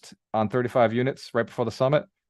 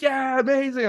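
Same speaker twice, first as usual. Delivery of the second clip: mock eager client.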